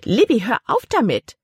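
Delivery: aunt ordering the niece to stop hiccuping; commanding. A narrator's reading. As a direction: order her to stop, sharply. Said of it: "befahl ihre Tante".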